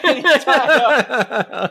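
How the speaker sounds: breaks into laughter mid-sentence; high, loud laugh through the words. Real, not acted. Telling friends about Rick, laughing hard.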